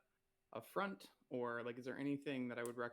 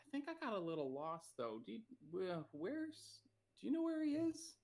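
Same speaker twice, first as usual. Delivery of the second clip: tipsy, giggling, rising higher and higher; drunk act; 4 semitones higher than usual. Acted. Drunk elf asking dockhands for cousin Roland, pitch climbing. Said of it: "drop the drunk act".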